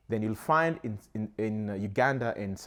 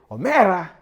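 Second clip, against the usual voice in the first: high and loud, a ringing call; high-pitched Luo call; 8 semitones higher, 10 dB louder than usual. Retold or imitated. Imitating a northern Luo greeting a friend, high and loud. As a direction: sing out the greeting high and bright. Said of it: "it's a very high-pitched voice".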